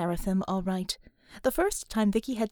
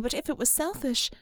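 ordinary delivery, nothing marked

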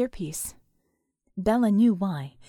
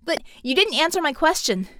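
higher, louder challenge; shouted; 5 semitones higher, 5 dB louder than usual. A narrator's reading. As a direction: call out, pressing for an answer. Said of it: "the masked girl shouted".

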